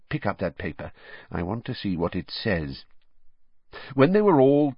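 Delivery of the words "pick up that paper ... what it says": much softer, said under his breath; undertone. Acted. Father quietly asks his son for the paper, in an undertone. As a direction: keep it low so the family won't hear. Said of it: "he added in an undertone".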